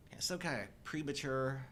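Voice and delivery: ordinary delivery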